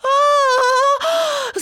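long, high panicked scream; parrot's scream. A narrator's reading. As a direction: shriek in alarm on one held note. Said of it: "зарепетував папуга". (пронизливий крик переляку)

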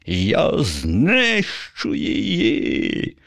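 high, very slow furious zombie cry; strange enraged voice. A narrator's reading. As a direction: yell it in a voice not your own. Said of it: "закричав не своїм голосом". (не своїм голосом, високо й повільно)

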